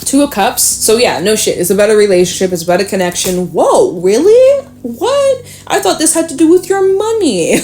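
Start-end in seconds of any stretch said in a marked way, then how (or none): 3.5-7.6 mock surprise. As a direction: act stunned by the card. Mock shock at the card.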